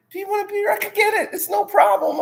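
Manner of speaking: high, overeager woman's voice; eager mock offer. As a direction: play her too keen to please. Imitating her eager offer.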